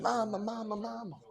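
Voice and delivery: high, softened wail; imitated crying. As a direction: mimic a tearful, held-out cry of "mama". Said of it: "and, and she starts crying".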